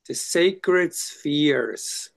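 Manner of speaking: sacred stretched long and voiced, each word savored; slow, savoring. Shown as a demonstration. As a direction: draw out "sacred" very slowly in full voice. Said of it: "Is it sacred or secret?".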